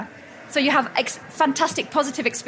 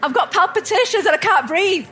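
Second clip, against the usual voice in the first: mock desperate plea; 6 semitones higher than usual. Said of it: "I says".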